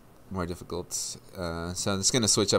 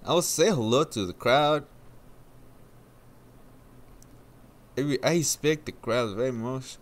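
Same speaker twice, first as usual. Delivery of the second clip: imitated interviewee, higher lilting voice; Fraga; 5 semitones higher than usual. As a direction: carry on the mock driver interview. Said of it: "they asked Fraga what he thought".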